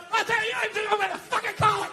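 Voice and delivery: high, strained dad outburst; angry dad. Retold or imitated. Dad tells off the priest, mock angry high voice. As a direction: imitate a father losing it at a priest. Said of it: "my dad started getting his leg on".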